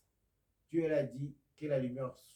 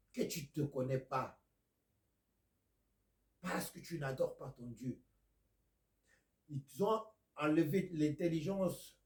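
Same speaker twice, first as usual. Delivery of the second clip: loud, high, insistent sermon delivery; fiery preaching; 5 semitones higher, 5 dB louder than usual. Acted. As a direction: hammer the point home to the flock. Preaching to the congregation.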